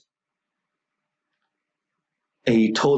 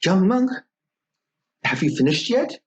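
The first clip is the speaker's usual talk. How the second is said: higher calling voice through a door; 8 semitones higher than usual. A narrator's reading. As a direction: call out to him, a touch impatient. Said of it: "the witch calls out".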